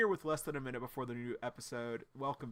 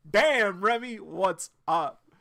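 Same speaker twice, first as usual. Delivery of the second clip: hyped reaction; 7 semitones higher, 11 dB louder, slower than usual. Retold or imitated. Reacting to Remy's plan to purge.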